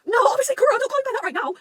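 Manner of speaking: high, loud exasperated outburst; frantic protest. Acted. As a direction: yell it in fan panic. Acted frantic reaction to the scene.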